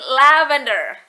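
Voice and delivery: "lavender" with LA punched, drawn out; stress on LA. Retold or imitated. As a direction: correct it slowly as LA-ven-der. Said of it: "Ada penekanan pada suku kata la".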